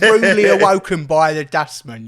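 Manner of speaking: loud Cockney recital; Phil Daniels. Acted. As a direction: declaim it in a punchy Cockney accent. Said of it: "it's such a Phil Daniels Cockney voice".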